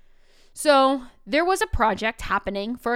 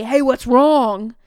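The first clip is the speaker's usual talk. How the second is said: louder, slower and pushier, leaning on "wrong"; the wrong, alarmed way; 3 semitones higher, 12 dB louder, slower than usual. Shown as a demonstration. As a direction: demo how not to barge in. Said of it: "I didn't go up to him and say".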